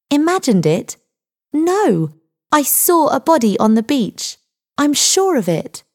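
put-on high-pitched, insistent young Linda; firm protest, pitched well up; firm insistence. A narrator's reading. Girl insisting to the doubting sergeant, firmly. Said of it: "answered Linda firmly".

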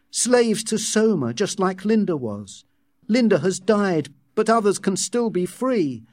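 high, resolute musing; impassioned thought. A narrator's reading. John resolving to free the slaves, impassioned.

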